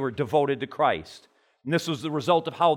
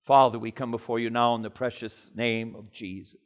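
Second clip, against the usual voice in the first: prayer; 4 semitones lower than usual. Acted. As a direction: pray humbly before God, hushed. Leads a prayer.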